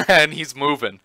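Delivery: amused disbelief with a quick chuckle; laughing. Real, not acted. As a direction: laugh as you point out the near miss. Car pulls out in replayed clip.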